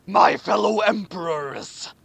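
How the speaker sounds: slow, lightly voiced old ruler; a slow hint of an old emperor's voice. A narrator's reading. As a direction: address the hall slowly like an aged king. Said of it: "the Emperor said old".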